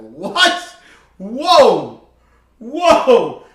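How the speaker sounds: loud, startled retold exclamation; shocked whoa. Telling how he reacted to the old man, shocked loud whoa. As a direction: recoil in mock shock at what he heard.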